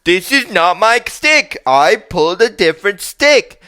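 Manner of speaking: put-on whiny little kid. Little brother whining at his big brother about the wrong stick, high whiny kid voice. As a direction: whine like a kid who got the wrong stick.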